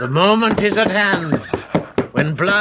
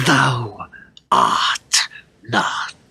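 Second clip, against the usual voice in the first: much lower, final put-down; cold contempt; 8 semitones lower, slower than usual. Acted. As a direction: crush her with the words. King puts the woman in her place.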